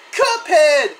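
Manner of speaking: high, loud scream of a name. A narrator's reading. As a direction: shout the name in alarm. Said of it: "you scream".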